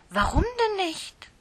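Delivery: sehr hoch und laut aufschreckend; entsetzt. Acted. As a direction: fassungslos hochfahren. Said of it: "fragte Lieschen entsetzt". (Lieschen hört, dass das Krippenspiel ausfällt)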